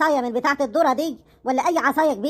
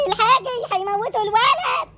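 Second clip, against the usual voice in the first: panicked shout; 7 semitones higher than usual. Acted. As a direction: scream that they'll kill the boy. تستغيث لإنقاذ الولد. (صراخ استغاثة عالٍ)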